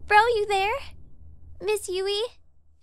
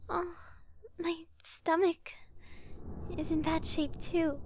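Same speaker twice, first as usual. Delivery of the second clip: whispered complaint; 2 semitones lower, 8 dB quieter than usual. Acted. Feeling sick from stress.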